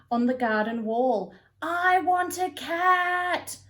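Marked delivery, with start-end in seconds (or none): 1.6-3.6 high, slow, stretched cat howl. Acted. Jessie howls from the garden wall like a cat, high and slow. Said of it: "she was howling".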